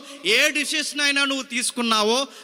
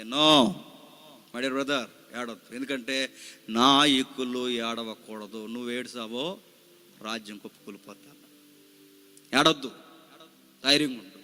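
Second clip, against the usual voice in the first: soft, low consoling plea to a grieving brother; sister consoling; 10 semitones lower, 4 dB quieter than usual. Retold or imitated. Sister gently urging her brother not to cry. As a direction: gently tell him not to cry, a touch hushed. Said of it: "Priyanka Gandhi ఇలా అంది".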